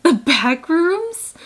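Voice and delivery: high, loud, drawn-out words; dramatic dread. Retold or imitated. Traveler seeing where the officer points, dramatic dread. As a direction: stretch it out in mock horror.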